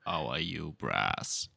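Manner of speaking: frog voice. Pretend. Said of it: "Is that why you sound like a frog?".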